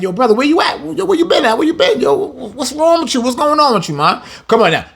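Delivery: high, excited street voice calling out to a friend; hey man, what's wrong with you; street brother checking in. Retold or imitated. A brother checking on his friend, high and excited. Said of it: "I'm like".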